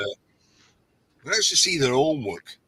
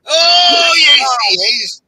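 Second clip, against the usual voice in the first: loud pantomime call and response; panto crowd callback; 5 semitones higher, 8 dB louder, slower than usual. Pretend. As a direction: yell it like a panto audience. Panto call-and-response about Bob.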